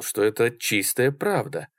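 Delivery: plain reading voice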